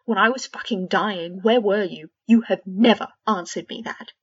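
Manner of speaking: loud, bitter accusation; furious. Acted. Fred accusing George of abandoning him, loud fury. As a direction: throw it in his face.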